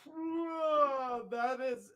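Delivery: surprised. Real, not acted. Sudden exclamation, speaker breaks off.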